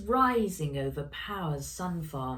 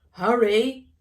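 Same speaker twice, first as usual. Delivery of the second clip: loud, higher urgent shout; booming hurry; 6 semitones higher, 10 dB louder than usual. A narrator's reading. Grandpa shouting to Ella through the solar phone, booming. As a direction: boom it down the phone. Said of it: "boomed Grandpa's voice".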